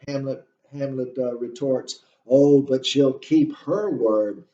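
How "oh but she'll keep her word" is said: slow, louder, dripping with sarcasm; sarcastic retort. Acted. Lecturer voicing Hamlet's jab at his mother, sarcastic. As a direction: let the irony bite on every word. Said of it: "you can hear the sarcasm in his voice".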